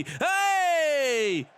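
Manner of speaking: sudden high loud yell; shout. Real, not acted. Gamer yelling at his team on screen, sudden shout.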